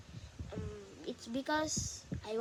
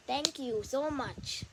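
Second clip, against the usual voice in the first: normal talking voice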